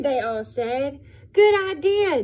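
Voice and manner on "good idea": high, loud cheer; happy praise. Acted. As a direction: cheer Duck on. Animals praise Duck.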